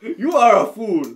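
high, loud shouted insult; mock screaming. Retelling the film characters screaming at each other, mock shouting. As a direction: holler it like a hammed-up film quarrel. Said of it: "they're fucking screaming at each other".

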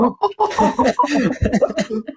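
cackling at his own joke; laughing. Real, not acted. After joking about a friend.

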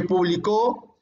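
"publicó" stressed on the final CÓ; stress on last syllable. Shown as a demonstration. Teacher showing how accent changes words, stress on CÓ. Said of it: "intensidad aplicado a las sílabas".

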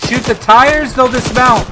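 loud, high urgent command; shouted order. Real, not acted. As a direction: bark it across the battlefield. Ordering fire at vehicle.

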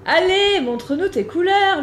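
high, loud eager call. Acted. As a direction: shout up to him to show off. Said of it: "lui crie Daphné".

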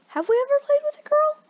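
very high squeaky question. Acted. Repeat in even higher voice.

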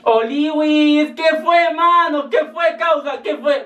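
the friend greets the reporter with a slangy hello; high cheery greeting. Acted. (saludo agudo y jovial al periodista)

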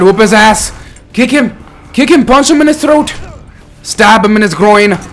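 loud, high-pitched fight cheering; worked-up yelling at the fighter; egging on. Real, not acted. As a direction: shout them on from the sidelines. Cheering on game character in fight.

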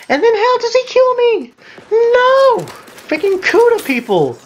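very high falsetto whine; mock outrage. Gamer killed by an opponent, squealing in mock outrage. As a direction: squeal in exaggerated high-pitched protest.

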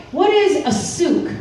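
slow, emphatic quiz question; slow reread. Shown as a demonstration. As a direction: spell the question out slowly. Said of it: "reread question".

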